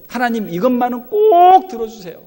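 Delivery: high, loud begging; pleading prayer. Acted. Preacher voices a believer's desperate plea. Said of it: "간절한 기도의 소원".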